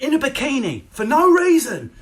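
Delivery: shrieking disbelief; incredulous shout. Real, not acted. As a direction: blast the absurdity. Exasperated shout at influencer.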